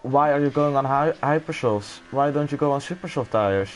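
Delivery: usual speaking voice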